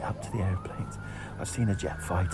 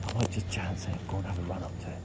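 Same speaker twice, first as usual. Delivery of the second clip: whispering; 3 semitones lower than usual. Real, not acted. Sneaking near guards at night.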